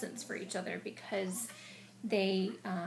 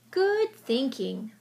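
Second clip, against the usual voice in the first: smiling praise; 4 semitones higher, 9 dB louder, much slower than usual. Acted. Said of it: "smiled Mole".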